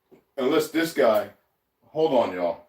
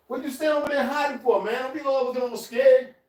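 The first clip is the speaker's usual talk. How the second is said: higher teasing voice for a pet; teasing the cat; 8 semitones higher than usual. Real, not acted. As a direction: playfully scold the scared cat.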